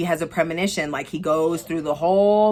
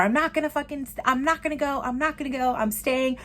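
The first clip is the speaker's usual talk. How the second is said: panicked refusal; 9 semitones higher than usual. Retold or imitated. Retold panicked driver refusing.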